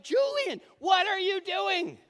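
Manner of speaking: high, loud panicked shout; Julian, what are you doing, shouted in panic; yelling his name. Retold or imitated. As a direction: shout across the pool in alarm. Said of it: "and I'm like".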